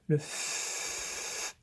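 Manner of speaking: "F" as a soft voiceless fff hiss, no vowel; letter sound demo. Shown as a demonstration. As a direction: breathe out "F" as just the fff sound. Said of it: "on va avoir le".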